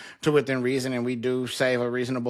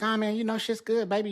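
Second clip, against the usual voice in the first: lightly voiced breezy high front, said much higher; breezy act; 10 semitones higher than usual. To his new therapist, a breezy high carefree front. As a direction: put on a carefree act, all is good. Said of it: "I'm like".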